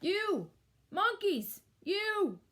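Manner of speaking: high, loud scolding call; cross peddler telling off monkeys. A narrator's reading. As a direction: scold them sharply, word by word. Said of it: "he spoke to them".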